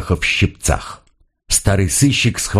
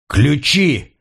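a higher, sharp barked demand; 6 semitones higher than usual. A narrator's reading. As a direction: Schiffer barks at the Turk for the keys: bark. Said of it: "рявкнул Шифер".

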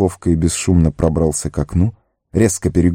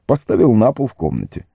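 ordinary delivery

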